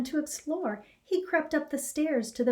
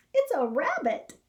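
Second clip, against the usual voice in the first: put-on young boy voice; 7 semitones higher, 3 dB louder than usual.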